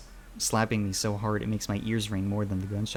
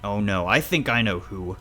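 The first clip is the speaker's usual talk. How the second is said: louder, disapproving father; accusing; 3 semitones higher, 6 dB louder than usual. Acted. Father blaming child's friend, disapproving. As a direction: press the accusation.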